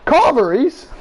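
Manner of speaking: high, incredulous echo on CARVERIES; mock disbelief. Friend questions ordering carveries, incredulous CARVERIES.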